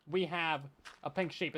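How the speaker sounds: ordinary talk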